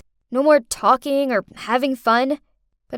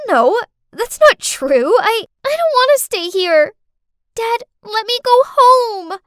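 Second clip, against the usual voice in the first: crying harder; 10 semitones higher, 8 dB louder than usual. Acted. Girl begs her dad to take her home, crying hard. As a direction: wail over the other person like an upset kid. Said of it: "she was crying harder".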